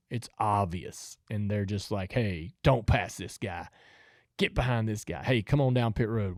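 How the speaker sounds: ordinary delivery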